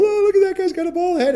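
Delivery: put-on high childish jeer; little kid mocking. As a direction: play a five-year-old pointing and teasing. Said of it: "five years old going".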